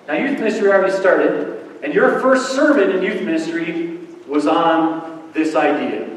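ordinary talk, nothing marked

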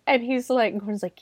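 ordinary talk

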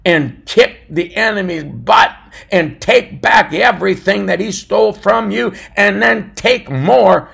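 loud, rising preacher's cry; fiery preaching. Acted. Pastor preaching to his listeners, building to a shout. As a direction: preach it with full force to the congregation.

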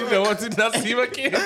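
quoting him through laughter; laughing quote. Retold or imitated. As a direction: retell it while cracking up. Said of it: "man said".

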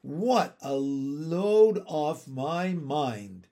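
slow, drawn-out relieved exclamation; sighing relief. A narrator's reading. Miss Lark unburdens her mind, sighing with relief. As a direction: stretch each word out with relief. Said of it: "sighing loudly".

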